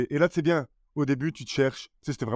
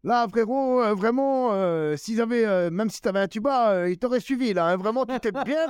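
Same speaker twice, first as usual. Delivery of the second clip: pitched way up, playful ribbing; Edgar teasing; 8 semitones higher than usual. Retold or imitated. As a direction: rib him like a mocking older comic. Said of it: "il a dit".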